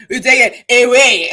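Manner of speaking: exaggerated mock African accent; Forest Whitaker African accent. Mocking the movie accent for viewers, exaggerated African accent. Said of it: "that's the accent you do".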